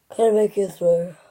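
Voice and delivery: words stretched by a yawn. Real, not acted. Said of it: "Sorry, I yawned".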